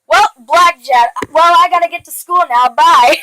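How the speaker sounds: mock-cheerful, breezy goodbye; exaggerated carefree kid; happy attitude. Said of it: "And, like, a happy attitude".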